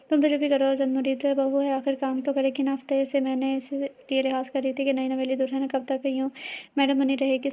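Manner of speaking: ordinary delivery, nothing marked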